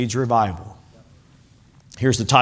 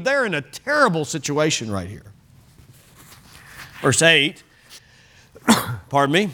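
ordinary talk

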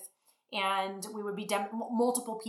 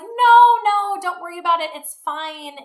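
much higher and louder, rushing to reassure; no no, brushing it off loudly and brightly; emphatic reassurance; 9 semitones higher, 12 dB louder than usual. Retold or imitated. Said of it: "And I was like".

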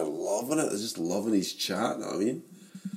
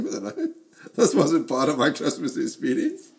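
laughing mock outrage; 6 semitones higher, 3 dB louder than usual.

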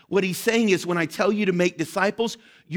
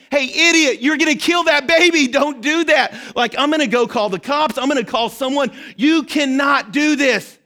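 furious shout; 7 semitones higher, 6 dB louder than usual. Pretend. Pastor imagines yelling at parents who dump their baby. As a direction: yell in horror to stop them hurting the baby. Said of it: "You would scream".

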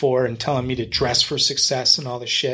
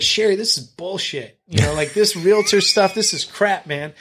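dismissive scoffing; 6 semitones higher than usual. Retold or imitated. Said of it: "that's what I would tell her".

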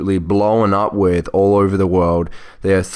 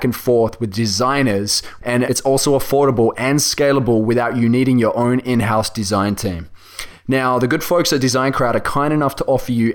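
ad read; 3 semitones higher than usual. Acted. Host reading a sponsor spot to listeners, upbeat ad read. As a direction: sell it like a podcast ad spot.

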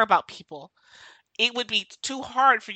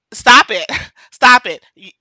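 usual speaking voice

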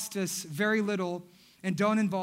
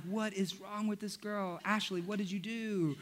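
hushed panicked dad; 9 dB quieter than usual. Retold or imitated. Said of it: "I'm like".

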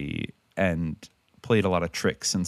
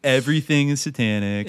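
mock moral panic; 6 semitones higher, 4 dB louder than usual.

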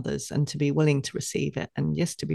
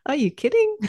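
much higher, laughing "are you kidding"; laughing disbelief; 12 semitones higher, 4 dB louder than usual. Retold or imitated. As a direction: scoff at the idea through a laugh. Laughing at her own old thought.

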